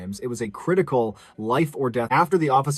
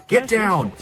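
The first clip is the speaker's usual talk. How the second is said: shouted surrender demands; 10 semitones higher, 5 dB louder than usual. Retold or imitated. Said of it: "switched to his sidearm".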